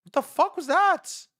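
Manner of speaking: disbelief. Real, not acted. Reacting to the announcement, slow high disbelief. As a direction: drag it out in disbelief.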